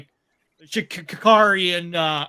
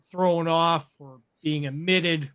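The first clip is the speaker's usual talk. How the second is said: ordinary talk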